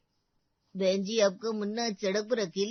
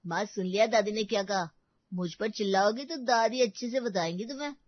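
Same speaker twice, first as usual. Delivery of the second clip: ordinary talk, nothing marked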